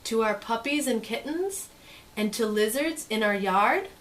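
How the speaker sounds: lively, sing-song storybook read; reading to children. Acted. Teacher reading a picture book to kids, lively and sing-song. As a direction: make the page sparkle for little listeners.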